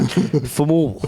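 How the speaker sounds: “Famul” drawn out, ending in -ul; put-on mob accent on “Famul”, middle vowel squashed; mobster accent. Shown as a demonstration. Coaxing Scott to say “Famul” in mobster accent. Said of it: "Say it with me, Scott".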